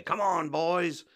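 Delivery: high, drawn-out mock call; Moe. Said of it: "We hear Mo say".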